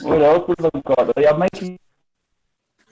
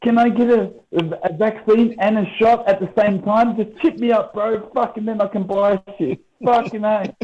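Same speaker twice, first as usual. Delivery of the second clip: much higher, mocking voice of a clueless Yank; silly high caricature voice; mocking dumb Yank; 9 semitones higher than usual. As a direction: lampoon a gullible American begging to be chipped. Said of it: "So they'll go".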